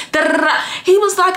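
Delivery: ordinary talk, nothing marked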